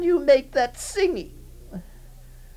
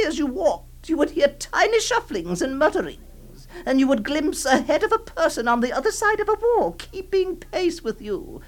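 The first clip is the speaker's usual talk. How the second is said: normal talking voice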